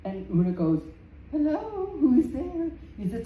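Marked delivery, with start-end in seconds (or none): 1.3-2.7 higher, lighter woman's voice; a hint of a nervous housewife answering; wife's voice. Una, the wife, calls through the door to the giant.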